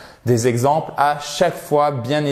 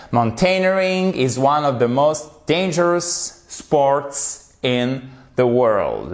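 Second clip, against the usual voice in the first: slow model reading. Shown as a demonstration. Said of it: "model sentence read slowly".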